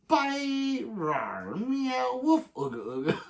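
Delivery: playful mix of animal sounds; silly animal noises. Retold or imitated. Reading Josh's goodbye as silly animal noises. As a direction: ham up each animal noise.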